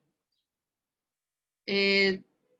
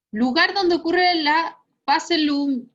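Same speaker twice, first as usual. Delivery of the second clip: slow dictation; 6 semitones higher, 6 dB louder, slower than usual. Shown as a demonstration. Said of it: "dictating the question".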